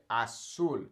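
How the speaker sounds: "Azul" as asul, falling; "Azul" with a plain S; S sound. Shown as a demonstration. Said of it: "It makes that S sound".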